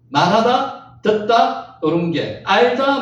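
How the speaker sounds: usual speaking voice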